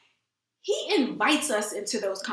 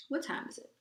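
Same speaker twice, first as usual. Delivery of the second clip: whispered aside; 4 semitones higher, 11 dB quieter, faster than usual. Real, not acted. Off-camera aside checking time.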